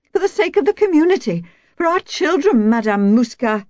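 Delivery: fast, very high mock plea; savage mimicry. A narrator's reading. Wife mimicking neighbour's community appeal, savage high mockery. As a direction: parody her sanctimonious plea high up. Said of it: "she mimicked savagely".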